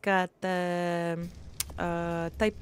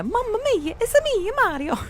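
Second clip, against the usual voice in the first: imitating a child's high, loud plumber impression; Mario; 6 dB louder than usual. Mother imitating her gaming daughter, high mock-Italian squeal. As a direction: squeal it like a kid playing an Italian cartoon hero. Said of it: "I hear her going over there".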